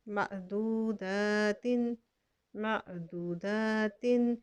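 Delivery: मअदूदातिन slow, with the final "in"; joined form. Shown as a demonstration. Teacher recites the joined form slowly. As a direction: draw out मअदूदातिन, voicing the nunation. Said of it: "ता के नीचे दो ज़ेर है".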